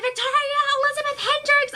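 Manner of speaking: mom calling my full name, high and sharp; mother calling out her daughter's full name; stern full-name call. Retold or imitated. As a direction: call her name like she's in big trouble.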